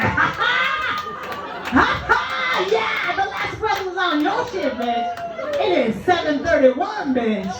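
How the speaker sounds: high-pitched, hammed-up triumphant taunting with cackles; mock gloating. Nurse clocking out taunts the next shift, gloating. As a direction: gloat in a high, laughing victory crow.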